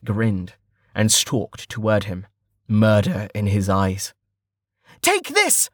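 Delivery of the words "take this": high, yelled taunt; battle shout. A narrator's reading. Archer shooting at bandit leader, shouting. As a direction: shout it as you attack, pitch way up. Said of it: "Stint shouted".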